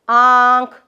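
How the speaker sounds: normal talking voice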